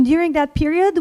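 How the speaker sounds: usual speaking voice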